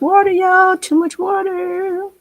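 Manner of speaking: high, slow dismayed cry of too much water; drawn-out alarmed exclamation at the paint; surprised exclaim. Real, not acted. Painter to viewers, startled by runny paint, high slow exclaim.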